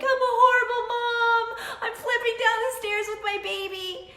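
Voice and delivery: high, loud, tearful retold wail; reliving crying. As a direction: replay the sobbing self-blame, pitched high. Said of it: "and I'm crying".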